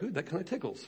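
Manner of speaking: imitating reluctant enjoyment; playful ticklish reaction. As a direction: coo it quietly as if being tickled. Acts out enjoying the foot wash.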